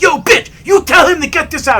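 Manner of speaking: high, loud angry yell; imitated screaming. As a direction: scream orders like an enraged man. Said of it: "he's screaming".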